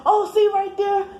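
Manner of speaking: high, eager replay of her own words; animated retelling. Retold or imitated. Telling the caller about noise harassment, a lightly voiced eager reply. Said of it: "And then I'd be like".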